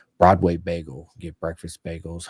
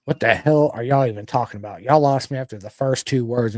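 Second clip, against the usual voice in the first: louder, baffled what-are-you-talking-about; exasperated outburst; 4 semitones higher, 9 dB louder than usual. Retold or imitated. Husband lost in his wife's fast chat, loud exasperation. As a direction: throw up your hands at fast chatter. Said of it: "I'm literally going like".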